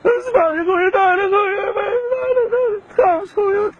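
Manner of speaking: high, tearful pleading, voice breaking into sobs; crying. Real, not acted. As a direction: cry out to him, begging. Victim breaks down in tears.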